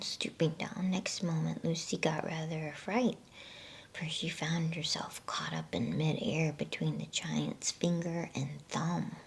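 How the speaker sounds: ordinary delivery, nothing marked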